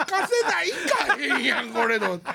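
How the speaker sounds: laughing exasperation. Real, not acted. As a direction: laugh at the absurd detour. Laughing at the wrong turn.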